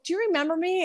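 high, sugary mock-surprised girl voice; gushing mean girl. Old high school bully greeting Leah in her salon, high mock gush. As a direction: imitate the fake-sweet high school bully.

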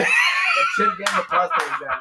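high laughing shout. Real, not acted. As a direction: crack up and shout it. Laughing at the beatbox.

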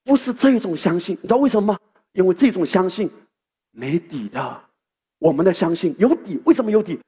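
ordinary talk

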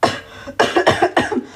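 choking coughs from thick smoke; coughing fit. Acted. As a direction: cough as the smoke hits you. Smoke pouring from jar.

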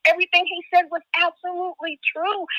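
ordinary talk, nothing marked

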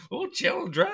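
very high, sing-out creepy villain call; child catcher. Acted. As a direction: lure the children in a high put-on voice. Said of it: "the child catcher voice".

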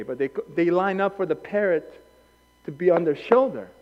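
ordinary delivery

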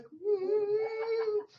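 high, long-held hum; drawn-out "mm". Retold or imitated. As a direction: let out one long, high "mm". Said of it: "I will like".

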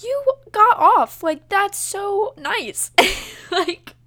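loud, high, drawn-out gushing; retold delighted squeal. To sister's boyfriend off work, loud delighted squeal. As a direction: replay the thrilled surprise, stretching the words.

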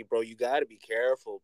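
normal talking voice

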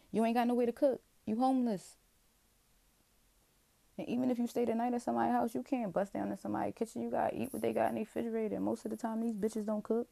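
worked-up loud rant; heated rant. Real, not acted. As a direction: pour out the frustration at full tilt. Ranting about homelessness.

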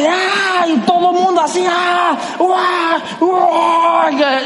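high, loud cheering yells; the whole stadium cheering the runner home; crowd roar. Retold or imitated. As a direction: whoop like a roaring crowd.

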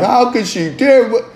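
high, drawn-out mock wail of outrage; mock complaining. Father imagining himself crying to his ex, mock complaining. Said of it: "all that crying and complaining".

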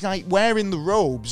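ordinary talk

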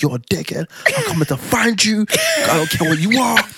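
high, loud South London threats; South London rage. Retold or imitated. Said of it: "I'm all South London on the phone like".

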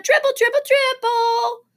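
put-on gleeful shout from a small child; hollering toddler. A narrator's reading. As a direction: bellow the pet's name like an excited little boy. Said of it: "Fudge hollered".

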